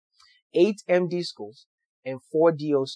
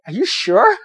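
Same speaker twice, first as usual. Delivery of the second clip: higher and louder, thrilled disbelief; excited disbelief; 10 semitones higher, 10 dB louder than usual. Retold or imitated. Retelling the acceptance phone call, thrilled and disbelieving. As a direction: blurt it out, hardly believing the news.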